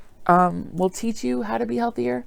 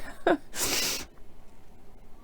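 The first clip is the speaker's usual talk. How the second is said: tearful laugh; 11 semitones higher, 10 dB quieter than usual. Real, not acted. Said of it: "I'm trying not to cry".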